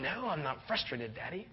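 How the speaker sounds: put-on soft, sulky little girl denial; sulky child voice. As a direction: deny it as a pouting child. Frustrated daughter denies it.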